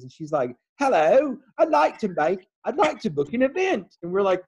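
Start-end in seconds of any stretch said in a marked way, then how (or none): 0.8-4.0 imitating a bad Australian accent, higher and louder; a friend's fake Australian accent, hammed up; mimicking Lisa's one Australian accent; mock Australian accent. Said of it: "your foreign voices are always Australian".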